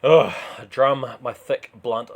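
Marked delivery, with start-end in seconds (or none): none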